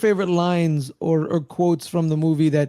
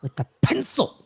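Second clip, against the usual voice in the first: a touch higher, mock awe; echoed quote; 5 semitones higher than usual. Fan repeating the pencil line with mock awe. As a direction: savor the absurd detail. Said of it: "I once saw him kill three men".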